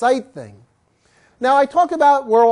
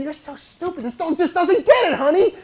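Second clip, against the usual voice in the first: higher, put-on complaining voice; exasperated owner; 7 semitones higher than usual. Owner griping to partner about dog, exasperated. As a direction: whine about the dog to your spouse. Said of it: "And you're saying".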